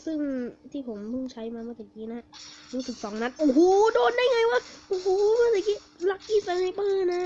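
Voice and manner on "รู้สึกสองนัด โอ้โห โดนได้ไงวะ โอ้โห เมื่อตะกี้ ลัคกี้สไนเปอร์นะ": loud, high amazed yelling; high-pitched whoop of disbelief; excited gamer shout. Real, not acted. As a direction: shout it out, thrilled by the lucky shots. Amazed by lucky sniper kills.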